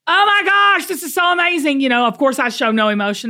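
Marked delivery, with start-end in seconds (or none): 0.1-1.8 high, loud gush of delight; thrilled mom. Retold or imitated. Mom reacting to her son's win, delighted gush. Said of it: "I'm sitting here as a mom going".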